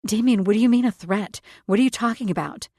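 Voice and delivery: high, loud and frightened; panicked. A narrator's reading. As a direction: push it out in panic. Heroine panicking.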